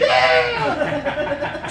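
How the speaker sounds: excited cheer. Real, not acted. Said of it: "David Frost all excited".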